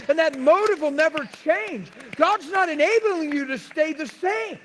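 loud, high preaching shout; full-force preaching. Real, not acted. Pastor preaching hard to the church, loud and high. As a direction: drive it home to the congregation at full volume.

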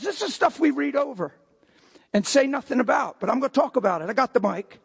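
usual speaking voice

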